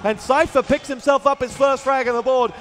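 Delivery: loud, rising play-by-play shout; excited. Real, not acted. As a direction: call the frag with a burst of excitement. Big frag in a duel.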